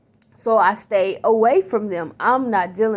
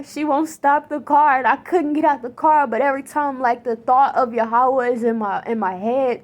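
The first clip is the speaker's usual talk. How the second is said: usual speaking voice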